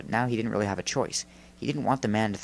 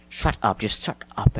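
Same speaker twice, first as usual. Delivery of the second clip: restrained angry yell; 4 dB louder than usual. A narrator's reading. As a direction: snap at him to silence him. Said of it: "Andrew yelled".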